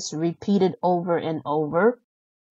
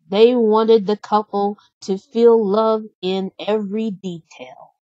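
ordinary delivery, nothing marked

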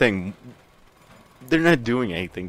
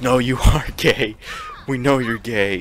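raised, higher-pitched fangirl outrage; exasperated pretend protest, louder at first; mock angry; 4 semitones higher, 5 dB louder than usual. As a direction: play-act getting mad and insisting. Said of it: "they would get mad".

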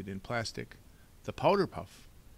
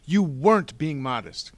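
loud, higher-pitched accusation; indignant cry; 6 semitones higher, 15 dB louder than usual. A narrator's reading. Boy cutting off the villain's excuse, indignant cry. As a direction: cut in loudly as an outraged boy. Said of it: "Klaus cried".

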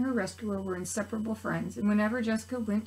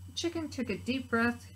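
usual speaking voice

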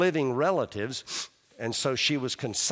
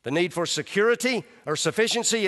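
normal talking voice

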